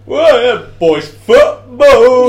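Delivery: loud, high laddish whooping; drawn-out, over-the-top terrace roar; shouted fan cheer. Shown as a demonstration. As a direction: fake being one of the football lads. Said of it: "shouting that is a bit of a red light".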